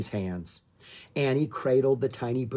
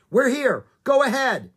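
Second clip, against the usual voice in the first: high, loud urgent reply; shouting; 12 semitones higher, 8 dB louder than usual. A narrator's reading. Jomo answers the emergency call on the radio, shouting. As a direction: shout back into a two-way radio. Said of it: "he shouted".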